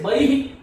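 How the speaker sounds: बही as "bahihi", rising on the echoed vowel; correct visarga. Shown as a demonstration. Teacher explains the i before visarga, "bahihi" for बही. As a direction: end बही with a breathed "hi". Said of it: "इस तरह से बही".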